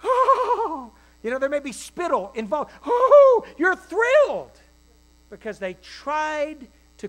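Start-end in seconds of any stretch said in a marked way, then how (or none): 0.0-0.9 high, loud delighted "Oh"; squealed happy exclamation; thrilled gasp. Acted. Preacher acting a delighted parent. 2.9-4.6 high, loud delighted "Oh"; squealed happy exclamation; thrilled gasp. Acted. Preacher acting a delighted parent.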